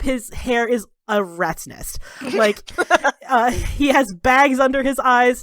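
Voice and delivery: ordinary delivery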